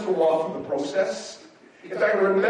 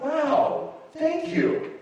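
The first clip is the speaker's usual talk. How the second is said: high, mild little thank-you; acting out an underwhelmed reaction, pitched up; polite mock thanks in a high voice; unemotional thanks; 10 semitones higher, much slower than usual. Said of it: "I'm not really an emotional guy".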